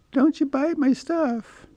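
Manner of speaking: pet voice. Real, not acted. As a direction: gently tell off the cat in a cutesy voice. Scolding the cat, pet voice.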